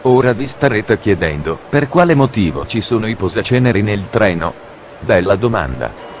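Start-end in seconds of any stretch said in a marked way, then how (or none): none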